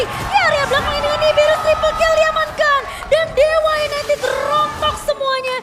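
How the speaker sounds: high, loud, hyped play-by-play shout; fighting game announcer. Acted. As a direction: roar the triple kill like an arena caster. Hype caster moment, triple kill. (teriakan komentator esports yang heboh)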